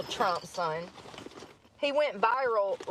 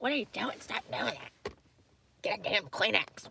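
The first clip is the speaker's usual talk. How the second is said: softer mock scolding, voice dropping away; scolding mom; 4 dB quieter than usual. Mom breaking a child's habit, imitated hushed scolding. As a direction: lightly imitate a mother telling off a kid. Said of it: "She's like".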